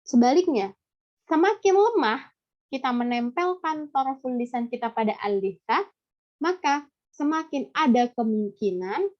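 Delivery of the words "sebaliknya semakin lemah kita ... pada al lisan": normal talking voice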